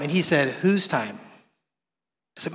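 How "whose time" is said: low, soft voice of God; calm challenge. Retold or imitated. God answering the complaining man, low and quiet. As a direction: quietly question him, pitched well down. God calmly questions him back.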